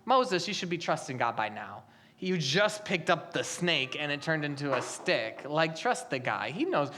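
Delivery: low, soft, matter-of-fact chiding; lightly voiced scolding. God's reason to Moses, low and mildly scolding. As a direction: gently chide Moses under your breath. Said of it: "One reason could be".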